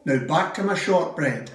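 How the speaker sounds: ordinary talk, nothing marked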